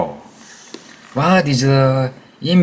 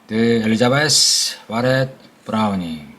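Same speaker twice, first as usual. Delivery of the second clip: ordinary talk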